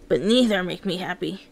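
usual speaking voice

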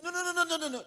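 loud, very high rapid-fire refusal; imitating friend's protest. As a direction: mimic a frantic man refusing, pitch way up. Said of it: "That's the way he talks".

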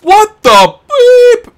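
very high, loud, slow squeal; cartoonish high outrage at a Walmart price; squeaky mock outrage. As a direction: squeal the censored curse. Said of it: "I'm gonna just go".

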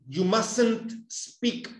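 You mustn't speak drawn out word by word; slow classroom model sentence. Shown as a demonstration. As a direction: spell out each word slowly for learners. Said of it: "También puede ser".

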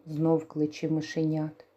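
usual speaking voice

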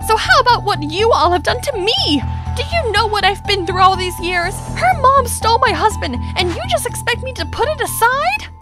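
high-pitched, aggrieved protest; anguished outburst. Acted. Wronged wife confronting them about her stolen husband, anguished. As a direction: pour out years of grievance.

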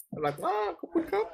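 very high, soft cutesy thanks. Real, not acted. Thanking a gift, cute reaction. (ขอบคุณของขวัญด้วยเสียงสูงน่ารัก)

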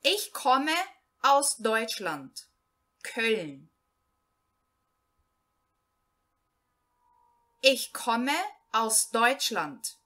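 slow, clear German read-aloud, each phrase set apart; slow learner reading. Shown as a demonstration. Teacher reading a German text to Portuguese-speaking beginners, slow and clear. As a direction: read it out slowly so beginners can follow.

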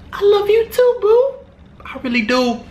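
very high, put-on sweetheart voice; lovey-dovey. Replying to a fan's love message, hammed-up lovey-dovey. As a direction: coo it in mock falsetto.

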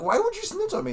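high, loud imitated protest; acted-out betrayed squawk; mock indignant. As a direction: accuse them in comic outrage. Acting out a film quarrel.

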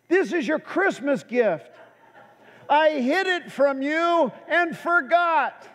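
playful mock announcement, drawn out at the end; lightly voiced wife. Wife reveals a forgotten Christmas gift to husband, lightly imitated announcement.

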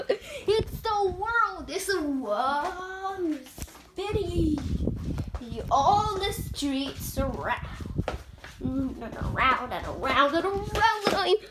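slow, dazed spinning; slow dreamy drawl. Pretend. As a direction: drift off dizzily. Dizzy spinning narration.

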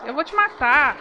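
loud furious yelling at the ghost; real rage in combat; angry shout. Real, not acted. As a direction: bark threats while fighting. Fighting the ghost.

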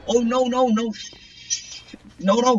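real fright as the monster nears; panicked no. Real, not acted. Panicked gamer in danger.

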